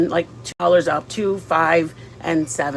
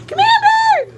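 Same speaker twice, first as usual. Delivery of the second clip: very high, sing-song coaxing call; coaxing a wild bird; 6 dB louder, slower than usual. Real, not acted. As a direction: coo them over gently, way up high. Coaxing someone to come close.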